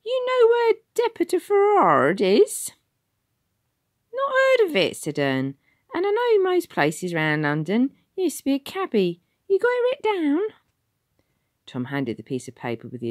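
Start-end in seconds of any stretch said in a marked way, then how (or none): 0.0-2.7 much higher, loud London voice; brushing the lad off; very high Cockney ticket man. Acted. Ticket man to Tom, high and loud. 4.1-10.4 very high, loud Cockney warden; a know-it-all old London driver; Ern the ex-cabby. Acted. Ern answering the ticket man, high and loud. Ern's voice.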